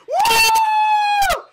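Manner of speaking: high, loud whoop; joyful whoop. Real, not acted. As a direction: let out a huge victory whoop. Celebrating the win.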